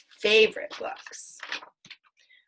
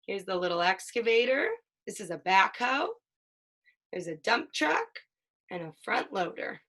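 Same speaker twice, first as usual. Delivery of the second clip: lively picture-book read-aloud, playing up the sound words; reading to a child. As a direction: read it like a bedtime book for a toddler.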